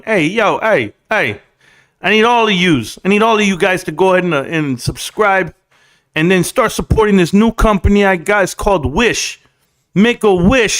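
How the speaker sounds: put-on lightly done New York hustler accent; New York wise guy accent. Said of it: "I don't do an Uncle Lou impression".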